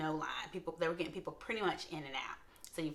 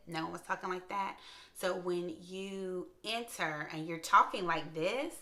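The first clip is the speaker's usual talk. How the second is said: ordinary talk, nothing marked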